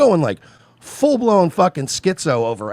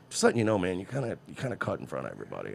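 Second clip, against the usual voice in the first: soft polite nudge; 7 semitones lower, 13 dB quieter, faster than usual. Retold or imitated. Polite word to gas line cutter.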